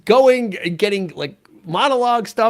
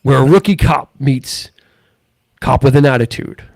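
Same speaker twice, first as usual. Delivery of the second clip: movie trailer voice; 5 semitones lower than usual. Shown as a demonstration. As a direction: boom it like a trailer. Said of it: "in a world".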